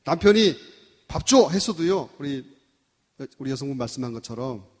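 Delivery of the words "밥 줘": loud, blunt two-word demand; demanding husband. Acted. Husband demanding his meal from his wife, loud and blunt. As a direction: snap it out like an order.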